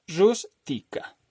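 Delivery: Rústica split slowly, RÚS-ti-ca; syllable by syllable. Shown as a demonstration. Teacher counting syllables for students, Rústica slowly split RÚS-ti-ca. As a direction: slowly break Rústica into three, strongest on RÚS. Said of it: "la sílaba tónica es la primera".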